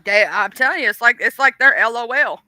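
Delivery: usual speaking voice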